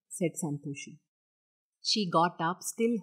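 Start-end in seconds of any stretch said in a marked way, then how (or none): none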